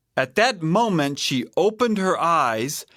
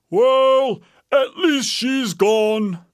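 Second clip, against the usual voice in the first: raised, drawn-out bear voice; Papa Bear; 10 semitones higher, 5 dB louder, slower than usual. A narrator's reading. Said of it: "said Papa Bear".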